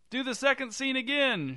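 high-pitched shouted demand. A narrator's reading. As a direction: call it up to the stage, high. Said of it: "shouted the director's husband".

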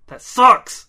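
loud disappointed gripe; loud complaint. Acted. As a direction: blurt it out in disgust. Man reacts, measures very loud.

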